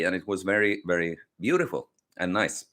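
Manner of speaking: ordinary talk, nothing marked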